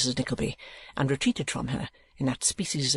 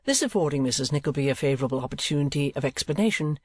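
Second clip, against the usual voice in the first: ordinary delivery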